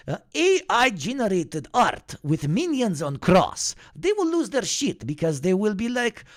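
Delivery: mock villain monologue in a cartoon accent; Gru impression. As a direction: scheme grandly as the cartoon villain. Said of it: "Gru talking".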